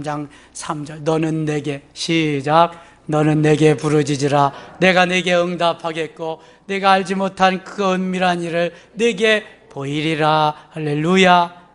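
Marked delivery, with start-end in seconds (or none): 1.0-11.7 loud, declaimed Bible verse; preacher's scripture recital. Real, not acted. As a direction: lead the congregation through the verse. Leads congregation reciting scripture. (힘주어 낭독하는 성경 말씀)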